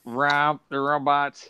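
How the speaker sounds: slow, monotone robot voice. Acted. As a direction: read it out like a machine, evenly and slowly. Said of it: "what has Robbie the Robot sound?".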